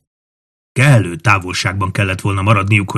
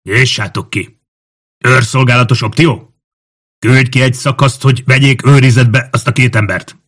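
raised, louder military orders; shouted command; 4 semitones higher, 5 dB louder than usual. A narrator's reading. Officer ordering soldiers at the gate, shouting commands. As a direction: call out orders to the waiting men. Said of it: "parancsot kiáltott".

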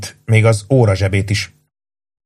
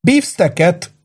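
high, astonished woman's question; startled, high-pitched exclamation; exclaiming; 9 semitones higher, slower than usual. A narrator's reading. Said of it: "kiáltott fel Missus Kipfer".

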